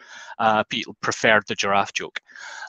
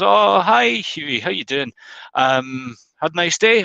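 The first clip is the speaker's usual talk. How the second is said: chirpy Glaswegian barman, pitched higher; 7 semitones higher than usual. Retold or imitated. Barman greeting the duck Huey, Glaswegian accent. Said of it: "He's Glaswegian".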